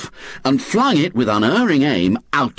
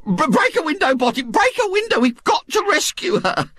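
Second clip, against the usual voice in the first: a much higher, breathless, fussy matron's voice pleading with her husband; frantic Mrs Bott; 12 semitones higher than usual. Acted. Mrs Bott begs her husband to break a window, frantic. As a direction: panicky wife begging Botty to act.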